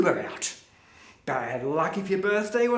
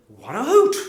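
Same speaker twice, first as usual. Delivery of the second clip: loud, gleeful recalled reaction; delighted exclamation; 3 semitones higher, 10 dB louder than usual. Retold or imitated. Comic recalls seeing the art news, loud delighted exclamation. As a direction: burst out loudly with amused delight.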